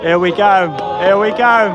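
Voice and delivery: real mounting thrill; building excitement. Real, not acted. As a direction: cheer the ride on as it sets off. Coaster starts its first test run.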